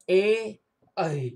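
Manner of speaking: ए and ऐ sounded out separately. Shown as a demonstration. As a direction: say ए then ऐ clearly. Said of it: "ए और ऐ का उच्चारण". (ए और ऐ अलग-अलग बोलकर)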